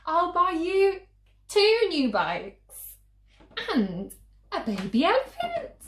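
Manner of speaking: high, loud, tumbling offers; excited mum. Acted. Mum's offers grow wilder.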